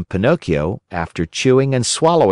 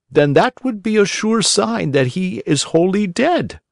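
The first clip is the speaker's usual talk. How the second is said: fussy owl doctor; 8 semitones higher than usual. A narrator's reading. Owl doctor politely contradicts the crow, high and prim. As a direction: apologise with prim owl dignity.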